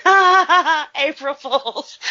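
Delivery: loud, high burst of mocking laughter; mock laughing disbelief. Host imagining the reaction twenty years ago, mock laughing disbelief. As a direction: laugh it off as an April Fools' joke. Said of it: "you would have been like".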